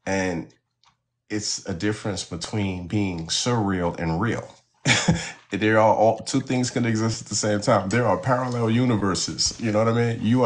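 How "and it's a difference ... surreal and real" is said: normal talking voice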